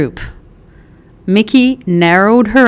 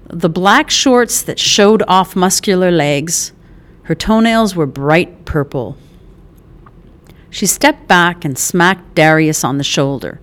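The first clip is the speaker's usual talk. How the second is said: usual speaking voice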